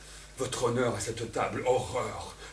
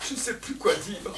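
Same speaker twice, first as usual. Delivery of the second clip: ordinary talk, nothing marked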